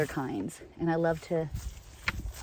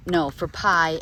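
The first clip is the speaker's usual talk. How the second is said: normal talking voice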